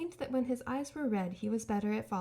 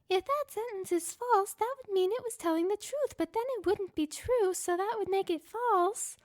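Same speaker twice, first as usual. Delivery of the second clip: put-on higher, louder childlike reasoning; high robot voice; 10 semitones higher, 4 dB louder than usual. A narrator's reading. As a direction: puzzle it out like a silly toy robot. Gur reasoning aloud.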